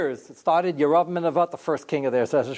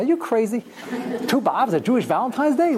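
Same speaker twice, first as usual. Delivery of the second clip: laughing, disbelieving retort, pitched up; incredulous; 6 semitones higher than usual. Retold or imitated. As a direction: scoff at the idea with a laugh. Retold disbelieving reply.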